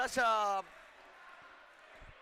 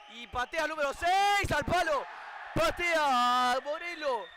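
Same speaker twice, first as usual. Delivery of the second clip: excited commentary; 4 semitones higher, 6 dB louder, slower than usual. Real, not acted. Penalty taken, live commentary.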